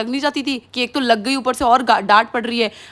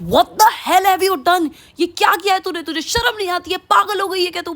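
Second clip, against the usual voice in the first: shocked mom scolding; 8 semitones higher than usual. Retold or imitated. Mother scolding daughter over her cut hair, high and horrified. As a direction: play the appalled mother, voice up high.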